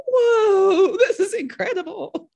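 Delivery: high, loud whoa with a laugh through it; giddy mock amazement, laughing; laughing amazed imitation. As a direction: imitate a thrilled host laughing. Host Jeff amazed at the finish.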